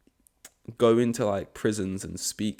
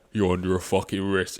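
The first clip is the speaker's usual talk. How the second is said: sudden gruff officer voice; deep cop voice; 2 semitones lower than usual. Retold or imitated. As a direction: drop into a deep cop voice to make the arrest. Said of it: "fucking deep voice as well".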